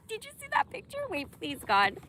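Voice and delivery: very high squeaky question through laughter; laughing. Real, not acted. Asking viewers about the photo, laughing high. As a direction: squeeze the words out mid-laugh.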